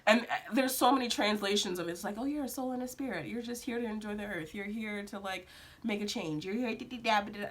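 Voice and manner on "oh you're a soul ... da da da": soft, rattled-off mock explanation; dismissive mimicry. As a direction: recite it dismissively, fading into da-da-da. Mimicking glib spiritual explanations.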